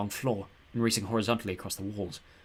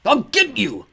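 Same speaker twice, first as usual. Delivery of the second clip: loud, high snarling threat; snarled threat; 12 semitones higher, 10 dB louder, much slower than usual. A narrator's reading. Ghost threatens them while fading, snarling loud. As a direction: spit the threat as he fades. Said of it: "Claude snarled".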